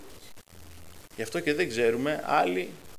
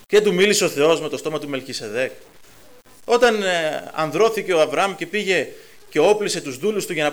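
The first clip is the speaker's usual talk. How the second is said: loud, driving sermon voice, pushing each question; fiery preaching; 7 dB louder than usual. Real, not acted. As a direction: preach it out full force to the congregation. Preacher builds to full force.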